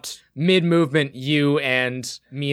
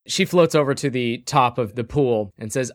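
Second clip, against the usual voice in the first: ordinary delivery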